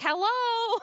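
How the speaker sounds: high, goofy hello; high airy mock hello; mock Harry greeting. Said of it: "He's always just like".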